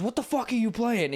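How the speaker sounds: high mock outburst of an angry dad; imitating angry dad. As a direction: play the shocked father. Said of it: "And my dad goes".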